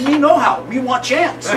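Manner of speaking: high, loud pidgin chief, played for the laugh; hammed-up chief voice. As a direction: pitch it way up for the punchline. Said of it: "He says".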